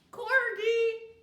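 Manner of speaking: high, loud proud answer; child proudly answering the teacher; eager child answer. A narrator's reading. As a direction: pipe up proudly.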